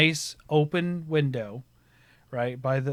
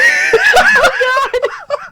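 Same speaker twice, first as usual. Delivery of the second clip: loud, very high helpless laughing fit; real laughter; 10 dB louder than usual. Real, not acted. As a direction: laugh till it squeaks. Laughing at own story.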